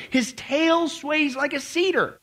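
thundering God. Acted. God rebuking Job from the storm, booming and slow. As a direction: challenge Job as an offended almighty.

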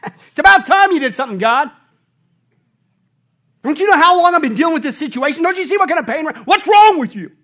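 high loud whiny demanding rant; entitled complaint. Retold or imitated. Preacher mocking people scolding God angrily. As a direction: berate God like he owes you. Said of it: "people today where they say".

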